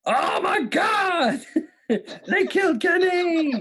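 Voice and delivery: very high, slow mock shout; catchphrase impression. Host performs cartoon clue for quiz, high drawn-out mock shout. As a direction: cry it out high in horror, hammed up. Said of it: "not doing it like they do on the show".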